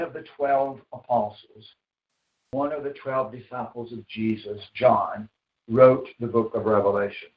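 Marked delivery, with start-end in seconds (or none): none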